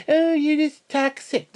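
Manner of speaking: high, slow mocking whine; mocking quote. Mimicking critics online, a high slow whine. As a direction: sneer it like a whiny critic. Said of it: "people think".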